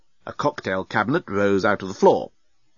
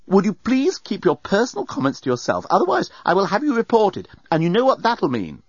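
higher, firm and indignant; stern warning; 9 semitones higher than usual. A narrator's reading. Passenger threatens to report bellbot, stern. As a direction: warn the robot off firmly.

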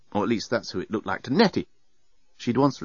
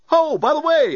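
high, loud, breezy aside; breezy robot; 5 dB louder than usual. A narrator's reading. Lamp to passenger on leaving, breezy. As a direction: toss it off cheerily in a high voice. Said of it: "it said breezily".

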